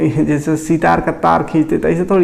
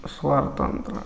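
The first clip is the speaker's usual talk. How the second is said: breathy voiceless repeat of the term; whispered; 10 dB quieter than usual. Shown as a demonstration. Teacher whispers the term back to the class. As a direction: drop to a whisper on स्वर तंत्र. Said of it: "यही है स्वर तंत्र".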